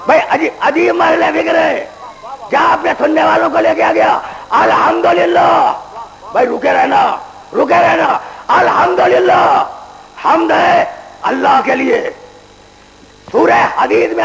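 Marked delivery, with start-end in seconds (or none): 0.1-9.6 raised, ringing sermon voice, higher and louder; full-force preaching. Acted. Preacher warns the young men about judgement day, in full-force preaching. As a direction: thunder it from the pulpit. Preacher climaxing, drawn out praise.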